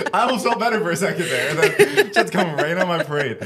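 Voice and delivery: amused groan of a complaint, laughing. Real, not acted. Laughing at his friend for spoiling his mood.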